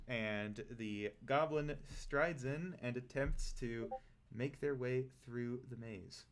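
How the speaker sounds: ordinary talk, nothing marked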